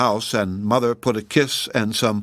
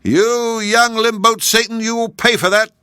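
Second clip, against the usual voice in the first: shrieking; 11 semitones higher, 5 dB louder than usual. A narrator's reading. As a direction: scream it at the boy who broke the window. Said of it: "running out of the house and shrieking".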